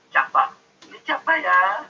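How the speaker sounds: siapa said as capa, cutesy; cute spelling. Shown as a demonstration. Lecturer showing siapa read as capa. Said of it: "bukan pakai S, tapi pakai C".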